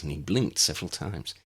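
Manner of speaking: ordinary delivery, nothing marked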